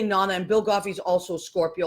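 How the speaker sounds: normal talking voice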